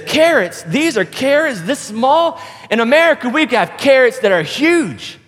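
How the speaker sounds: high-pitched, incredulous boast; bragging American, pitched well up; prideful American. Acted. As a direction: scoff in a high voice, stretching the size words. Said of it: "this prideful American".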